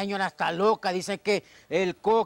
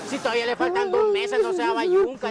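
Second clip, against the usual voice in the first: squeaky protest; 10 semitones higher, 3 dB louder than usual. Acted. Chepe protesting in high voice.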